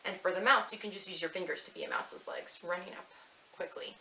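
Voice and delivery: ordinary talk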